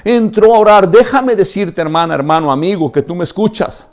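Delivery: high, impassioned preacher's call; fervent preaching. Acted. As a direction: reach out to every listener. Pastor exhorting the congregation. (predicación fervorosa y aguda)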